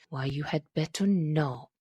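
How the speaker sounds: low, weighty repeat of why you had better not; the dying father pressing his warning on the lovers, emphatic; said very emphatically. A narrator's reading. Dwell on not with heavy emphasis. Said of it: "he repeated very emphatically".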